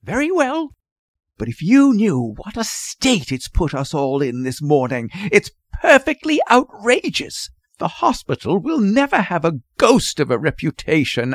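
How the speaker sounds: hushed indignation leaping high in pitch; haughty, scandalised nurse; indignant. A narrator's reading. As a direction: scold him in outraged disbelief. Contemptuous nurse scolds the father.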